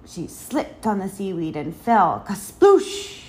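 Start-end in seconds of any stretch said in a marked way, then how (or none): 2.3-3.3 loud, high imitated splash; explosive storybook kasploosh; splash sound. A narrator's reading. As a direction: burst it out like a body hitting water. Sound of falling into water.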